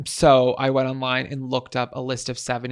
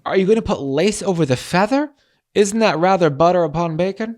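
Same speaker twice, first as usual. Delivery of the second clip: raised, louder theatrical questioning; prim Victorian speaker; 4 semitones higher, 5 dB louder than usual. Acted. Reading the example sentence to co-host, theatrical Victorian. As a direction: read it out as an affronted Victorian.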